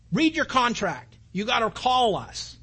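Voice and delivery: stern scolding. Retold or imitated. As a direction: snap it out like a cross scheduler. Scheduler scolding on phone.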